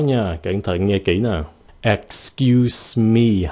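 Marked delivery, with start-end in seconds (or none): 1.8-3.5 slow teaching model of "Excuse me" with long s's; two hissed s sounds. Shown as a demonstration. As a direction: EXCUSE ME very slowly, both s sounds held. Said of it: "hai cái âm xì".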